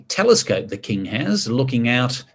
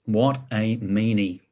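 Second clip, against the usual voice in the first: usual speaking voice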